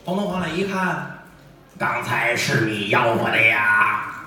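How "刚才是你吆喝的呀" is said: put-on slow, low pompous old man; old official. Official questions the tofu seller, slow and pompous. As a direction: speak like a self-important old official.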